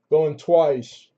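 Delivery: lively auction calling; auctioneer call. Real, not acted. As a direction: call out the bids to the room. Closing the auction lot.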